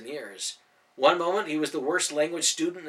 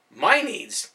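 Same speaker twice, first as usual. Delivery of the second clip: high, louder offended echo; indignant; 7 semitones higher, 7 dB louder than usual. A narrator's reading. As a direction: throw his words back at him, offended. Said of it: "Rachel asked indignantly".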